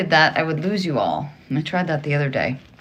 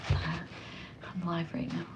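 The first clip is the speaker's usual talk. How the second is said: whispering; 14 dB quieter, much slower than usual. Real, not acted. Aside whisper to caller while live.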